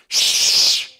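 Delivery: loud, long hissed shush; frantic shush from a hiding place; urgent shushing. Retold or imitated. Hiding Gideon silences the angel, loud shush. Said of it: "And Gideon starts yelling".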